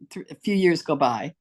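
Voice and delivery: normal talking voice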